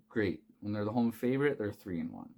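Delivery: ordinary talk, nothing marked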